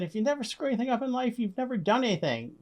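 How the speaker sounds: high, quick German accent imitation; lightly voiced German foreman. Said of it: "very heavy German accent".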